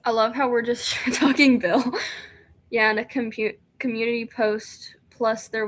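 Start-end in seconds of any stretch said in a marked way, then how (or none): none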